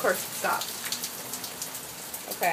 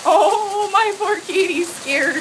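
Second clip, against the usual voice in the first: pitying coo; 9 semitones higher, 4 dB louder, slower than usual. Real, not acted. Pitying the frightened cat.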